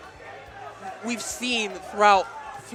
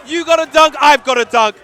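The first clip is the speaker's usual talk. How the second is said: shouting; 9 semitones higher, 14 dB louder than usual. Real, not acted. Hype exchange of dunks.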